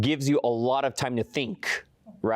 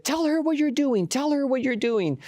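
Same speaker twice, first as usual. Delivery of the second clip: very high-pitched insistence; retold frantic demand; 14 semitones higher than usual. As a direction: imitate a worked-up woman giving orders. Retold screaming woman makes demand.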